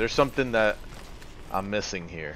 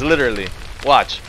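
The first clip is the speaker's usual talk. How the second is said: usual speaking voice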